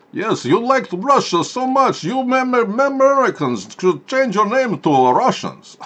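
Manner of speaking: high, slow, loud hammed-up Russian; mock Russian accent. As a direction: gloat slowly like a cartoon Russian villain. Mock Russian voice taunting Americans.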